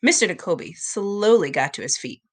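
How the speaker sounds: ordinary delivery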